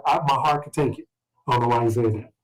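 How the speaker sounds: ordinary talk, nothing marked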